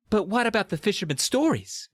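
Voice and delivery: high-pitched woman's objection; protesting. A narrator's reading. Miss Wickerman to Doctor D, acted high protest. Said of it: "Miss Wickerman protested".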